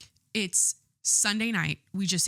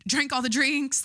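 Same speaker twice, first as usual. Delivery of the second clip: higher and louder, a gleeful punchline; excited; 6 semitones higher, 4 dB louder, slower than usual. Retold or imitated. Reliving the Super Bowl party, higher and louder with glee. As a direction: belt the word drinks out like a party cheer. Repeats the phrase with a burst.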